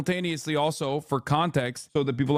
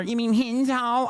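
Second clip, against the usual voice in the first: mocking whiny chatter; 5 semitones higher than usual. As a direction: imitate the annoying subscriber's question in a higher pitch. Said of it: "thirty-four month subscriber".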